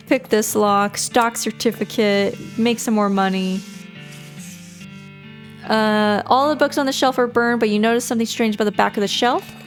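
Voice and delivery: normal talking voice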